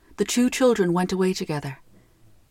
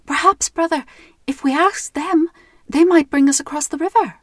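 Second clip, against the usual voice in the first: put-on girl voice; 7 semitones higher, 4 dB louder than usual. Gretel suggests to Hansel.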